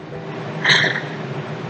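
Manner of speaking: snorting laugh. Real, not acted. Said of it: "there's my snort when I laugh".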